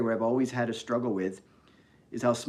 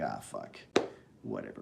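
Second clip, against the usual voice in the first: much lower and softer, giving up; resigned mutter; 10 dB quieter than usual. Retold or imitated. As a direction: mumble it under your breath, defeated. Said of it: "So it's like".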